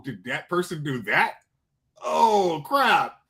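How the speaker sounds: normal talking voice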